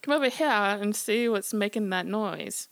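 Foghorn Leghorn Southern. Retold or imitated. Teller imitates Foghorn Leghorn calling, Southern holler. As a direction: ham up a Southern rooster holler. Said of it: "like, Foghorn Leghorn".

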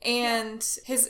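normal talking voice

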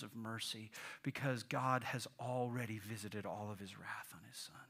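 soft, low, earnest assurance; gospel's reassurance. Acted. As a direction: quietly reassure the ashamed. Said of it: "the gospel comes rushing in and says".

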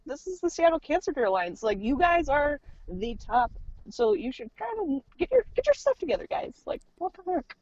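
high-pitched retold indignation; mock-outraged complaint; exasperated scolding. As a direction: tell them off, pitch climbing as it goes. Scolding the cancer center, retold.

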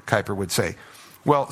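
usual speaking voice

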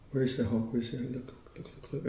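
sinking into a rapid whisper; hushed eager searching. Acted. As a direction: murmur it to yourself while hunting for something, fading to a whisper. Acting out searching for a hawk.

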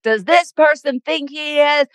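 high, outraged imitation; mock the husband's outrage, high. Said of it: "he was like".

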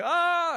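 high loud panicked "Ah"; a loud alarmed yelp; panicked gasp. Retold or imitated. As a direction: cry out in sudden panic. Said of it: "and I'm panicking".

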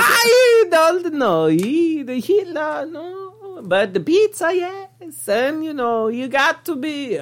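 very high, wailing mock Italian voice; vague Italian. Vague Italian dodging every question, high wailing accent. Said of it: "I wanna call him the vague Italian".

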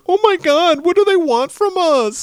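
very high, squealing panic; mock a terrified wimp; hammed-up cowardly shriek; whiny scared guy. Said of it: "He's like, ... such a little bitch".

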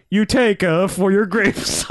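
high, slow fake Italian accent; hammed-up pidgin Italian accent; mock Italian accent. Kid offering a diamond to the farmer, badly faked Italian accent. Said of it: "you don't have to do the accent".

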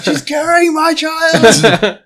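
high wailing sob, slow; wounded soldier gasping. Acted. Dying hero melodrama at table.

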